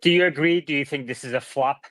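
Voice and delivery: ordinary talk, nothing marked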